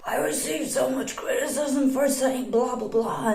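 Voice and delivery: a light mock of a dramatic YouTuber. Mocking YouTubers who overstate the criticism, lightly put on. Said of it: "YouTubers always are like".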